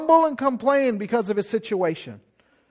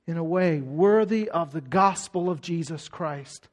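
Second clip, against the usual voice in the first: much softer and lower, earnest; hushed intensity; 5 semitones lower, 6 dB quieter than usual. Acted. Preacher urges the congregation to proclaim faith, hushed. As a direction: drop to an intimate hush.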